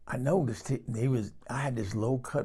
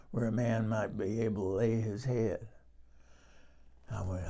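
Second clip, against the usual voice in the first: ordinary talk, nothing marked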